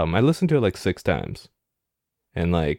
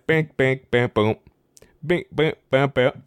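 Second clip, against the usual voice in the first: mouth beat; 2 semitones higher, slower than usual. Retold or imitated. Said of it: "the song goes".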